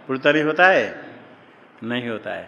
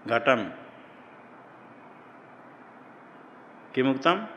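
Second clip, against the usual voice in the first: घटम, then a long silent pause before किमुक्तम; long gap between words; 10 dB quieter than usual. Shown as a demonstration. Teacher showing a gap between words, long pause between them. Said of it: "घटम कह करके आगे जो किम कहा".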